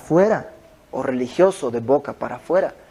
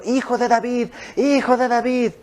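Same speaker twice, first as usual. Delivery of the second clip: clamor agudo y teatral, sin gran volumen; grito imitado; 7 semitones higher than usual. Retold or imitated. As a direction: imita a una mujer llamando con insistencia. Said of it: "ahí va la mujer gritando". (grito fingido, agudo e insistente)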